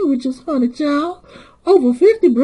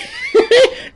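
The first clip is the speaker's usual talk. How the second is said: high-pitched giggle burst; short, high laugh. Real, not acted. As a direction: crack up briefly. Cracks up at own question.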